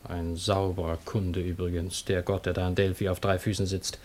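quiet sneer at the oracle god; sardonic aside. Acted. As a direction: mutter the jab aside.

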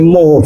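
mu sounded with an O, as "mo"; predicted mistaken pronunciation. Shown as a demonstration. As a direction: let mu come out as a rounded "mo". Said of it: "mesti muni".

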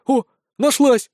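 slow, high exclamation; surprised. A narrator's reading. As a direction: react in pleasant surprise, pitch jumping up. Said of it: "удивился сержант". (удивлённый возглас)

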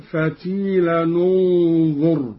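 فتيلا ننظر joined as fatilan-u-nzur, slowly; tanwin with damma. Shown as a demonstration. Teacher demonstrates joining the words for students, slow. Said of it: "هكذا".

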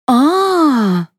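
high, stretched "aah"; drawn-out "aah". A narrator's reading. Mother turning on the narrator, drawn-out exclamation. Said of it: "протянула Флора".

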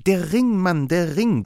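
hoch und laut, erregt; eindringlicher Ausruf. A narrator's reading. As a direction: dem Freund das Offensichtliche erregt zurufen. Holmes erklärt eifrig das Offensichtliche. (aufgeregt betontes Ausrufen)

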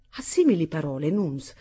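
ordinary delivery

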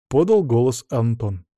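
usual speaking voice

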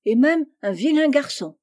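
exclaiming. A narrator's reading. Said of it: "s'écria Amy". (exclamation de reproche appuyée)

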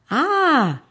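high mock scream of a scared girl; startled scream. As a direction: shriek like a frightened child in a story. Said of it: "empieza a chillar". (grito de susto fingido)

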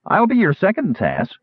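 high-pitched glee, dropping on the name; gleeful. A narrator's reading. Friend volunteering for the kender's duel, gleeful. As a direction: jump in delightedly. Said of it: "Kitiara said gleefully".

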